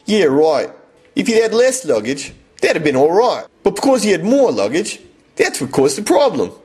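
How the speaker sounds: broad Aussie, pitch jumping high; Australian Cockney accent. Acted. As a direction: explain it earnestly in a thick Australian accent. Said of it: "She was speaking the Australian equivalent of Cockney".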